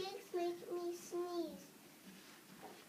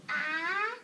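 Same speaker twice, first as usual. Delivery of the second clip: rising, louder "ah" building to a sneeze; pre-sneeze gasp; 6 dB louder than usual. Acted. Gerald the elephant about to sneeze, pre-sneeze gasp. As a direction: wind up for a big mock sneeze.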